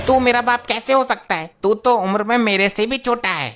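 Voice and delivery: indignant disbelief. Acted. Child disputes boy's claimed fatherhood.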